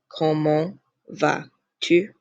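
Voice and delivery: "Comment... vas... tu" spaced out word by word; slow French pronunciation demonstration; slow model phrase. Shown as a demonstration. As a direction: teach it slowly, pausing between words. Teacher repeats it slowly for learners.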